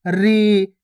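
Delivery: री with a long drawn-out ई; long ree. Shown as a demonstration. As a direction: hold the ई in री. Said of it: "खींच खींच के उच्चारण कर देना".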